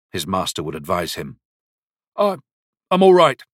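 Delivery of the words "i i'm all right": plain reading voice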